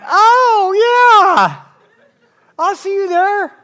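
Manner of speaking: high, loud burst of mock delight; hammed-up cry of realisation; excited dying man. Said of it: "He's like".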